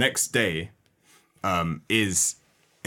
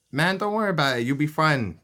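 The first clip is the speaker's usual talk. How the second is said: higher, light mock pep talk; lightly voiced buddy cheering him up; friend reassuring; 8 semitones higher than usual. P J reassures a worried friend, in a lightly higher pep talk. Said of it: "P J's turns to him".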